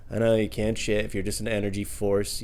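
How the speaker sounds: usual speaking voice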